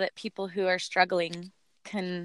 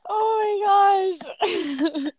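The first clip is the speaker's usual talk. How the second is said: excited shriek; 11 semitones higher, 5 dB louder, much slower than usual. Real, not acted. Amazed at friend's helium voice.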